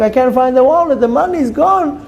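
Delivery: high-pitched distressed outburst; uptight. Acted. Husband telling his wife the money's gone, high and uptight. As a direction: wound up, dragging out the bad news.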